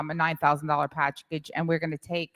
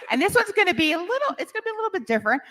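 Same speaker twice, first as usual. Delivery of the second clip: laughing; 11 semitones higher, 3 dB louder, much faster than usual. Real, not acted. Laughing about Boca Raton plans.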